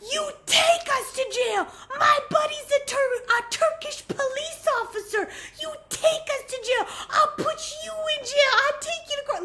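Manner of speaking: high, loud retold tirade; yelled counter-threat. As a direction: imitate a passenger furiously out-threatening the driver. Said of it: "crazy things being said in this car".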